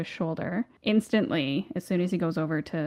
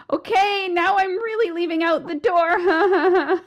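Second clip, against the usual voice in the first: much higher, slow, laughing embarrassed squeal; laughing through it; 12 semitones higher, 4 dB louder, slower than usual. Retold or imitated. Stepping out of the closet, embarrassed laughing squeal. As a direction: squeak it out while cracking up. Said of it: "had to come out of the closet and be like".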